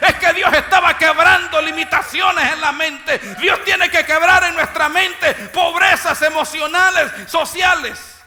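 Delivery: loud, high preacher's cry; preaching at full force. Acted. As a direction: preach it out to the church. (predicando a todo pulmón)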